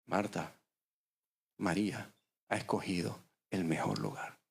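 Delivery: the Lord answering quietly, low and soft; preacher voicing Jesus, low and hushed; gentle Lord. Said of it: "Y el Señor le dice".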